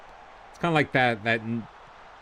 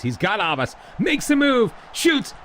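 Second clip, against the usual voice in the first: excited play-by-play; 10 semitones higher than usual. Real, not acted. Gamer calling his striker's shot, rising excitement.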